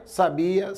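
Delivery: sabia, stressed on the BI; stress on middle syllable. Shown as a demonstration. Teacher contrasting same letters for students, stress on middle syllable. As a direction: lean on the i of sabia, sa-BI-a. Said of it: "Se eu deslocar a sílaba tônica".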